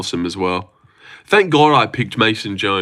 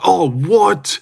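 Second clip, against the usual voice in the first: higher-pitched indignant disbelief; mock outrage; 6 semitones higher, 3 dB louder than usual. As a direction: imitate an outraged fan calling it unfair. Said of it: "anyone would talk shit like".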